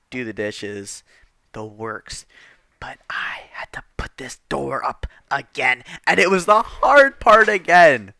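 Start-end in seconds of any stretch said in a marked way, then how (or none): 2.8-5.8 unvoiced, drained whisper; weary whisper. Real, not acted. Worn out teen dreading the door again, whispering. Exhausted complaint. 6.1-8.1 higher, louder, slow exasperated stress on hard. Real, not acted. Teen complaining about the door again, exasperated.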